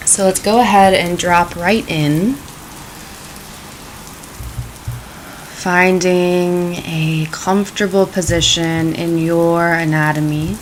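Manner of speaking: calm, unhurried meditation voice; guided meditation. Acted. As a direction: ease them gently into the practice.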